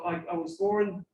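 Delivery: usual speaking voice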